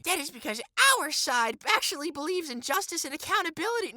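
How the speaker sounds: very high put-on writer voice; exaggerated high mocking quote; mocking article voice. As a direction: read it as a high-pitched parody of the writer. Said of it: "I keep doing the voice".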